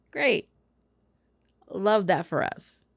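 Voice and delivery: slow, deadpan fake approval; drawn-out sarcastic praise. Real, not acted. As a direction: pretend to love it, drily. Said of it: "That's sarcasm".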